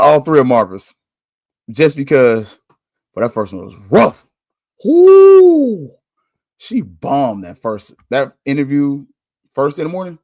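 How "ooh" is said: long, loud, high "ooh"; big wincing ooh at a bad showing. Real, not acted. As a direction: let out a drawn-out high-pitched ooh. Reacting to a rough interview.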